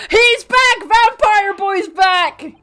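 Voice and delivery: high, loud, slow gleeful shout; excited. Real, not acted. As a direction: herald his return with glee. Excited announcement of judge.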